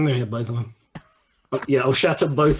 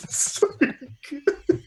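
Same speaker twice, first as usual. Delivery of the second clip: real helpless laughter; 13 semitones higher than usual. Real, not acted. Game master delighted by the players' antics, laughing. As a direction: squeeze the words out while laughing.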